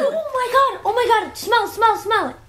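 overjoyed, urging everyone to smell; excited squeal. Real, not acted. Child smelling new Oreos.

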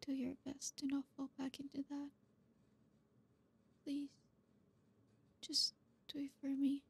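put-on hushed, tender comforting boyfriend. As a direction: murmur close as if holding a crying partner. Tender whispered comfort roleplay.

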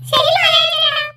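put-on children chorus to Mayavi; eager loud reply. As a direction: shout yes to your hero.

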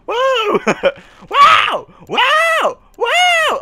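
very high, loud whoops; amazed shouts. Real, not acted. Amazed at fire power.

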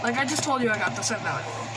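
usual speaking voice

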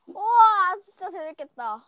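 slow amazed gasp; loud wide-eyed wow. Real, not acted. Kid sees fun game choice.

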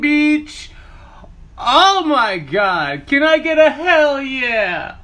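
loud, high excited yelling at the oven; hyped shouting. Real, not acted. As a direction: shout it out, over the moon about rising muffins. Hyped showing off rising muffins.

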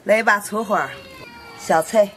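ordinary talk, nothing marked

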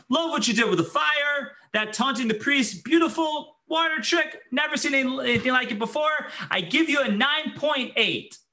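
high, mocking applause for the prophet's show; sarcastic praise. Jezebel mockingly praising Eliyahu's miracle show. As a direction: gush over it with fake admiration. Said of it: "And Jezebel says".

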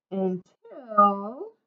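引き伸ばした「until」、上がり調子で間を溜める; 「until」をゆっくり伸ばし、語尾を上げて次を待たせる; drawn-out suspense. A narrator's reading. Suspense before the twist.

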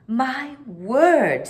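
Mary Poppins admiring the picture; admiringly. Acted. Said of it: "said Mary Poppins admiringly".

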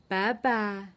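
normal talking voice

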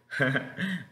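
a short embarrassed laugh; real laugh. Real, not acted. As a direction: laugh at a slip. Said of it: "I'm sorry guys". (risa apenada)

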